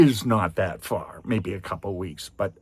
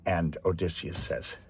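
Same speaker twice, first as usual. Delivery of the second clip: usual speaking voice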